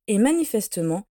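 ordinary delivery, nothing marked

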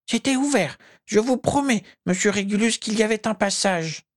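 very high, squeaky elf voice; high-pitched, earnest protest; insistent elf. A narrator's reading. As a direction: plead to be believed in a tiny creature voice. Elf insists to his master.

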